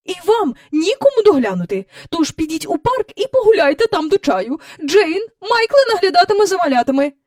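put-on mother sends the children out, firm and brisk; stern. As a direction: lay down the law.

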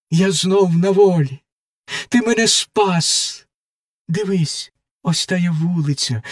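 lightly voiced girl rising into rapture; joyful young prisoner. Margarita hears her lover's voice, rising joy. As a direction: let joy break through as she recognises his voice.

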